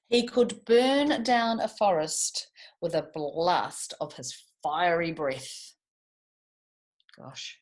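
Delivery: slow, dramatic storybook telling; storytelling. A narrator's reading. Teacher opens George and the Dragon for the class, dramatic storytelling. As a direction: build the mystery of the dragon's lair.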